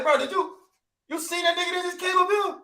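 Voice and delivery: high, excited street voice; street-talk Israelite. Retold or imitated. Retelling Goliath's arrival as a street guy spotting him. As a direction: act like you just spotted someone huge.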